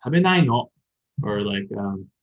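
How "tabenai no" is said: higher, louder feminine scold; gently but firmly tell him not to; tabenai no as a feminine scolding; feminine reprimand. Shown as a demonstration. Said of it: "the feminine way to say that".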